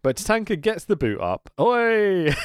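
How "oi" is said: sharp high Oi; mock shout. Imitating the crowd's chant during the comeback, sharp shout. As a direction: bark it out like the crowd.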